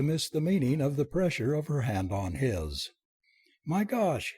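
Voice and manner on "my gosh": high-pitched amazed outburst; lifted, startled cry; wild exclamation. A narrator's reading. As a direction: exclaim as realisation hits. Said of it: "he exclaimed wildly".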